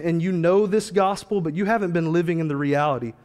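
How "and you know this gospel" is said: normal talking voice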